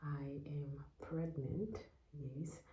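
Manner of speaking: soft, slow whisper; whispered reveal. Real, not acted. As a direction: let the news slip out softly. Shy reveal of her pregnancy.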